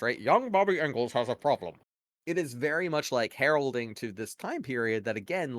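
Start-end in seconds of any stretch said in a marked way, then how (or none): 0.2-1.8 imitating an old public service film announcer; mock fifties announcer, pushed a little above normal talk. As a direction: put on a school-film narrator voice. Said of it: "like, announcer voice".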